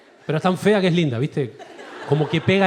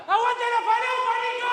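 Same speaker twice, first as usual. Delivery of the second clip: much higher mock yell out a car window; shouted from a car; 3 dB quieter than usual. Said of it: "Y me grita".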